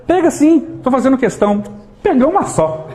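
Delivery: hammed-up insisting; insistent offering friend. Friend urging the guest to take a cookie, pushy and high.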